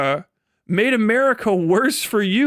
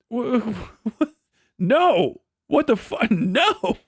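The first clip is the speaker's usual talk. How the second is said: sputtering through laughter; broken laughing no; laughing disbelief; 2 semitones higher, slower than usual. Real, not acted. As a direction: stammer it out cracking up.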